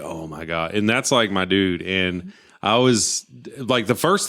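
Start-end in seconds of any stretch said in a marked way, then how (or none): 0.0-0.7 low, soft, awestruck murmur; hushed awe. Retold or imitated. Fan seeing Stone Cold's night begin, hushed awe. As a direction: breathe it out in quiet wonder. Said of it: "I was just like".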